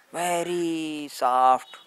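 very slow, stretched-out words; drawn out slowly. Shown as a demonstration. Meditator showing how slowly he used to walk, drawn out slowly. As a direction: stretch each word like slow motion.

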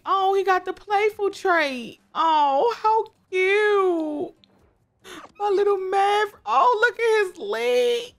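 very high, loud, tearful squeals; overwhelmed by cuteness, slowly; crying with joy. Real, not acted. Gamer seeing her newborn foal, crying with joy. Foal's playful trait shown.

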